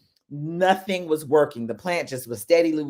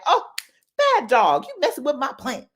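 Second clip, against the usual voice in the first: mock scolding; 11 semitones higher, 3 dB louder than usual. Scolding the dog over plant.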